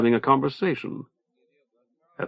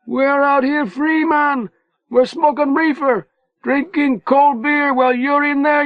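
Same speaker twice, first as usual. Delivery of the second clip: screaming; 13 semitones higher than usual. A narrator's reading. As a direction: scream insults over the wall. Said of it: "Manny was screaming his lungs out".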